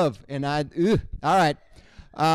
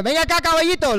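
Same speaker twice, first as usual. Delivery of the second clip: high, louder sweet talk to a pony in Spanish; calling a horse over in high Spanish; coaxing Spanish to a horse; 10 semitones higher, 5 dB louder, much faster than usual. Acted. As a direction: coax the little horse along in Spanish. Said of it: "they don't speak any other languages".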